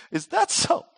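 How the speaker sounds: high, taunting sibling retort; childish comeback. Acted. Siblings trading silly insults in a fight, high childish retort. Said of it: "calling each other all kinds of goofy, childish names".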